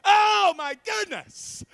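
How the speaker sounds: high, slow, loud wail; hammed-up dismayed holler; mock dread. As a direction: wail it out in comic horror. Comic dread of father.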